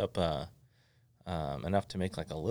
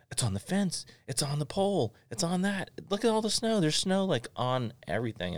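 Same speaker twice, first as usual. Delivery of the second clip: high, giddy listing of every snowy thing; excited pointing; 10 semitones higher than usual. Retold or imitated. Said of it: "I'm like".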